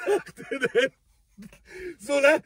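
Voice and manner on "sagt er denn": ordinary talk, nothing marked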